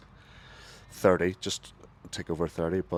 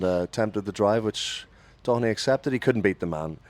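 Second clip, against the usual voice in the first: ordinary talk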